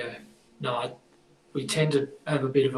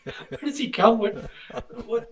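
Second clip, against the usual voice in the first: laughing disbelief; 8 semitones higher than usual. Real, not acted.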